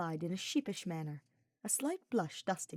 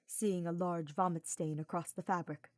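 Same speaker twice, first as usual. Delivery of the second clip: ordinary delivery, nothing marked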